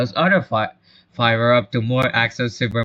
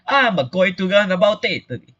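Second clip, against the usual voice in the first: put-on higher broad Italian accent; 6 semitones higher than usual. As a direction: announce it high in stage Italian. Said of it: "talk like a broad Italian stereotype".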